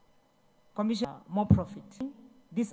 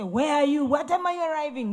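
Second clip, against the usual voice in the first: loud, higher demanding dad; mock angry father interrogating daughter; harsh father; 7 semitones higher, 8 dB louder than usual. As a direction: bark it like a stern father. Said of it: "No, he doesn't talk like that".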